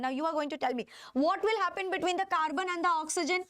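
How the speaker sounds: ordinary talk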